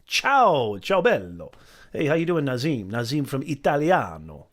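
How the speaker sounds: loud, hammed-up Italian greeting; terrible Italian accent. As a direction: call out in a bad mock Italian accent. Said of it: "sorry for the terrible, uh, Italian accent".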